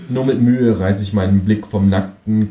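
ordinary talk, nothing marked

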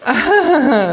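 very high forced laugh; hostess's artificial laugh; shrill fake laugh. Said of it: "lacht die Frau künstlich".

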